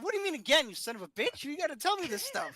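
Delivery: incredulous shout down the phone; exasperated yell. Retold or imitated. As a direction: demand it like he betrayed you. Angry retort to brother on phone.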